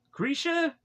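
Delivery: puzzled question. Real, not acted. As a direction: ask "Grisha?" as if the name makes no sense. Questioning the name.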